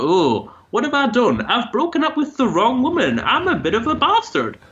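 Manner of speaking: high, lilting attempt at a British accent; terrible UK accent. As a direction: put on a bad UK accent that slides towards Scottish. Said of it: "That was a terrible accent".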